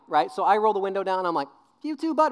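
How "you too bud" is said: put-on high, loud, chirpy kid call; mock child's cheerful shout; imitated four-year-old. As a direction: pipe up like a little kid out a window. Mimics himself as four-year-old.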